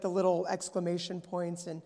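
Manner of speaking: normal talking voice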